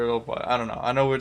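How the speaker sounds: ordinary delivery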